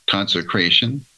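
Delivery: normal talking voice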